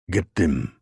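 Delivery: big German bellowing at his men; bellowed. Acted. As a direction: roar the order to charge. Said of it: "bellowed the big, bald German".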